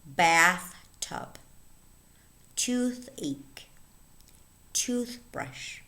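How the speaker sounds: BATH in "Bathtub", TOOTH in "toothache" and "toothbrush" stressed; stress on first word. Shown as a demonstration. Teacher modelling compound words, first halves of "Bathtub", "toothache", "toothbrush" stressed. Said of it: "the stress falls on the first word".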